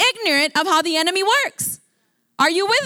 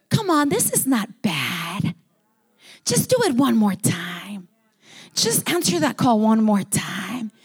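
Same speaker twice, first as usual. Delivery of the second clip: coaxing devil voice, lower, lightly put on; tempting devil; 4 semitones lower than usual. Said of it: "That's how he talks".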